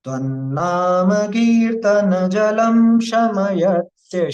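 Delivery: slow chanted Sanskrit shloka, each syllable drawn out; slow verse recitation. Shown as a demonstration. Teacher chanting the shloka for the class, slow and measured. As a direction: intone the verse slowly and reverently.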